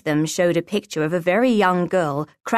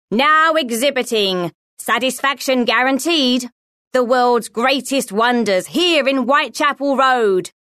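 higher, projected showman's cry; raised voice; 6 semitones higher, 4 dB louder than usual. A narrator's reading. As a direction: raise your voice over the street sellers. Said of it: "raised his voice a little".

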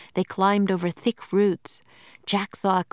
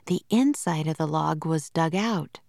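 ordinary delivery, nothing marked

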